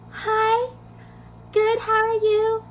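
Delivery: high, held, stiff little greeting; starstruck squeak. Retold or imitated. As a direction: imitate a nervous fan squeaking politely. Replaying her starstruck reply.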